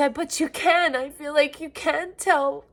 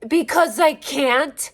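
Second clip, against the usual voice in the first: ordinary delivery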